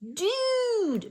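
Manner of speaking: high drawn-out surfer dude; high, slow surfer "Dude". Acted. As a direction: stretch it like a laid-back surfer. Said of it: "Mister Dan said".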